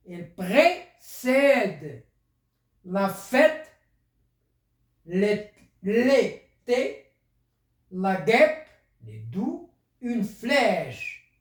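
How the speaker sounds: very slow, each word stretched; slow dictation. Shown as a demonstration. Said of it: "teacher dictating word list".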